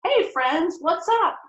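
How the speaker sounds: cheery greeting. Shown as a demonstration. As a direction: greet your friends with a lift. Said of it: "We might say in English".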